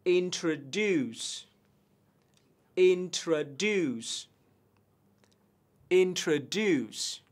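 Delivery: "introduce" very slow, DUCE stressed; slow model pronunciation. Shown as a demonstration. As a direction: draw out "introduce" slowly syllable by syllable. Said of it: "Từ tiếp theo". (đọc rất chậm "introduce")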